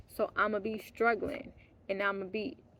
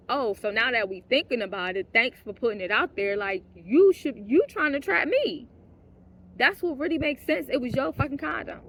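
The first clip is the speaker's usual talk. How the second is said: retold fury; 2 semitones higher, 5 dB louder than usual. Retold quarrel, rising shouts.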